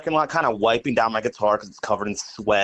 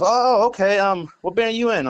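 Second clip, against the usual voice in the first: lightly cartoonish stranger; middle-aged man; 10 semitones higher than usual. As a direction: imitate the stranger asking, pitched up. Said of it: "he goes".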